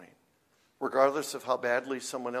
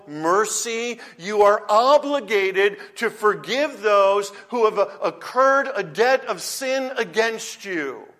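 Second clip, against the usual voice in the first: loud, slow, raised preaching; emphatic obligation; 4 semitones higher, 6 dB louder, slower than usual. Acted. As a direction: drive every word home, slow and forceful. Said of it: "The emphasis here is on the obligation".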